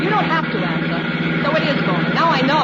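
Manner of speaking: high, loud furious accusations; angry shouting. Acted. Shouting at the hired man while speeding.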